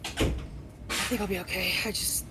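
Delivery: recovering from choking; hoarse after coughing up water. Real, not acted. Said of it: "are you okay?".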